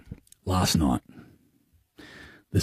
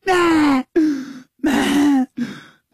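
much higher mock baby calling for mum; imitating a baby; 13 semitones higher, much slower than usual. Dad mimics his newborn son going red, high baby cries. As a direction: pitch right up like a straining baby. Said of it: "He's going".

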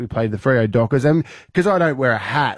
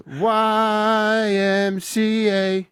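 very slow, higher, spelled-out letters; 8 semitones higher, much slower than usual. Shown as a demonstration. Shows co-host the right YMCA, very slowly. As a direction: drag each letter out to prove it. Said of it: "You do a weird C".

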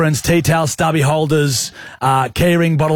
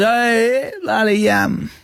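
higher, hesitant mate voice; Smithy impression; 7 semitones higher, much slower than usual. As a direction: put on Smithy's casual drawl. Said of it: "Smith Tech impression".

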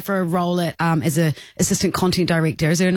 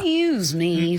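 slow, timid customer; much slower than usual. Retold or imitated. Imitating Lou at Bunnings.

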